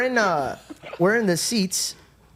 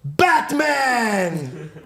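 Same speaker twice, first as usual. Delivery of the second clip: long, drawn-out higher holler; heckling shout; 4 semitones higher than usual. Acted. Imitating friend trolling the trivia host, heckling shout. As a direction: holler it across a packed theater. Friend yelling wrong answer out.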